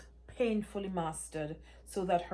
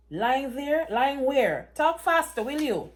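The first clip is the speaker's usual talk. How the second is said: much higher and louder, demanding; frantic shouting; 7 semitones higher, 10 dB louder than usual. Acted. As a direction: yell at him to hurry up and tell. Daughter shouts urging Mark on.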